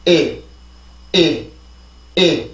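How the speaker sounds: 이 as a short, isolated falling vowel; vowel drill. Shown as a demonstration. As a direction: chant 이 evenly with pauses. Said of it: "자, 해볼게요".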